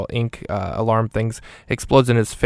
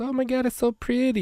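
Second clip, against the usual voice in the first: much higher, gushing cartoonish voice; Michael Pena voice; 14 semitones higher than usual. Recapping the movie, voicing the hitman seeing the money, high gushing squeal. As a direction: squeal it like you're dazzled by the cash. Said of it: "he goes".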